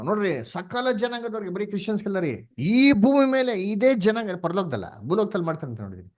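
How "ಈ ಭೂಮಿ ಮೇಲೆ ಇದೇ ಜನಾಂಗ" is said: high, loud and slow proclamation; forceful preaching to the church. Real, not acted. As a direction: proclaim it to the congregation.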